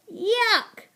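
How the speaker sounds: high, acted yuck; disgust. A narrator's reading. Boy under poured slime, disgusted. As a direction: recoil from the goo.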